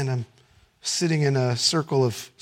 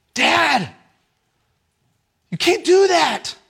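raised mock angry yell at dad; teenage yell; 10 semitones higher, 4 dB louder, slower than usual. Pastor imitating his teenage self yelling at dad, mild mock yell. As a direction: replay a teen's outburst, higher and louder. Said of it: "I'd get angry and yell".

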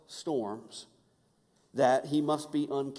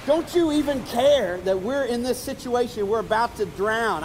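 raised, high-pitched frustrated plea; desperate yell; 7 semitones higher, 5 dB louder than usual. Retold or imitated. Disciples yelling to Jesus in the storm, desperate yell. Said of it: "they yell out to Jesus".

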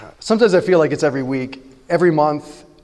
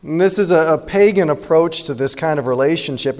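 usual speaking voice

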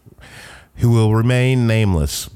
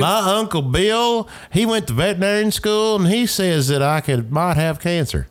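mock clueless patient; 8 semitones higher than usual. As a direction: play the naive patient quoting her uncle.

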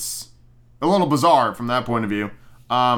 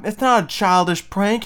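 higher, incredulous protest; outraged protest; 7 semitones higher than usual. Retold or imitated. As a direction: argue back in disbelief. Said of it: "and I'm like".

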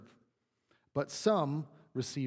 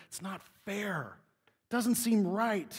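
drawn-out, higher-pitched protest; mock complaint; 5 semitones higher, slower than usual. Preacher playing worker paid less than latecomers, mock indignant. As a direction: slowly stretch it into a wounded grievance.

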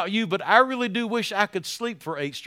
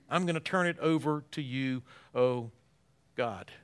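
quiet surrender; 6 semitones lower, 6 dB quieter than usual. Acted. Quiet surrender voiced for David.

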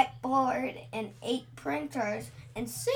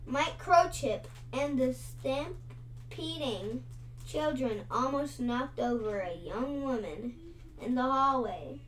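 usual speaking voice

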